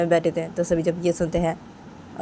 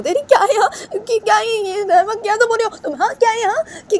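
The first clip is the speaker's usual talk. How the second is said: very high, loud tearful protest; crying denial; 10 semitones higher, 3 dB louder than usual. Acted. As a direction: sob out the denial, pleading. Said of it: "तो बानी वो ऐसे सब बातें बताने लगती है". (बहुत ऊँची रोती हुई सफ़ाई)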